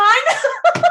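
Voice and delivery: a loud high burst of laughter. Real, not acted. Laughs after punchline.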